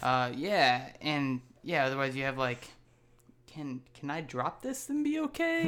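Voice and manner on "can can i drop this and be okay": worried, pitched way up; high worried voice. Retold or imitated. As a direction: squeak it like a nervous owner. Mock worried quote, very high.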